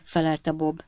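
plain reading voice

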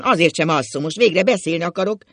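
loud, high, defiant outburst; cantankerous. A narrator's reading. Ákos insists to his wife on talking, cantankerous defiance. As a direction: dig in and declare it loudly. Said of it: "mondta Ákos hepciásan".

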